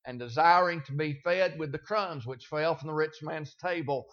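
soft, steady Bible reading; gentle pulpit Scripture reading. Acted. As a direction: read the passage quietly and reverently. Reads the Scripture passage aloud.

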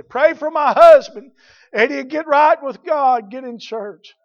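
high, loud, slow plea; drawn-out imitation of an urgent plea, easing at the end; imitated pleading mother. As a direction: voice a mother begging the church for prayer. Mother voiced begging prayers.

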